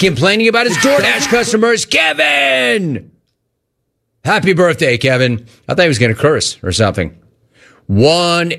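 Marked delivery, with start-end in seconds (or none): 1.9-3.1 drawn-out call. Acted.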